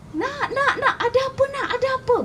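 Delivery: high, fretful mother's voice; worried mother. A narrator's reading. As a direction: fuss anxiously in a high voice. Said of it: "Kata mama dia". (suara ibu yang cemas, nada tinggi)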